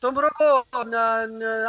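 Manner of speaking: ordinary talk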